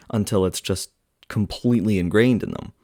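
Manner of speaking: ordinary talk, nothing marked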